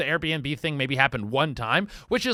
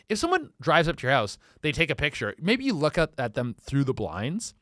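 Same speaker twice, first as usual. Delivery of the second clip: normal talking voice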